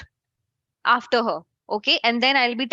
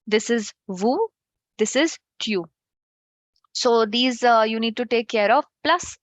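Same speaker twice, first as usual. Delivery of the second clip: ordinary talk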